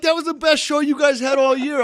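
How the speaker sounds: high, loud indignant argument; indignant protest. Retold or imitated. Suspended driver defends his race to the official, loudly indignant.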